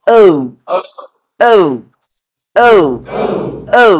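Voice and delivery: ও with the mouth pressed a little; tight o. Shown as a demonstration. Teacher corrects students' ও with the mouth pressed. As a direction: round ও with slightly tightened lips. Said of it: "মুখটা একটু চাপ দিয়ে ধরে হবে".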